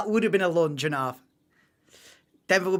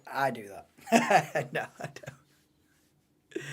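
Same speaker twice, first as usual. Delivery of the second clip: laughing; 5 semitones lower, 5 dB quieter, much slower than usual. Real, not acted. Laughing at own admission.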